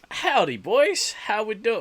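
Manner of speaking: slow, high, loud cowboy drawl; fake cowboy accent. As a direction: greet them like a hammy cowboy. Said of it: "I don't know why I put on a cowboy accent".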